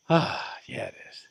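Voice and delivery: unvoiced soft agreement; whispered. Real, not acted. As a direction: whisper an answer to yourself. Whispered answer to own question.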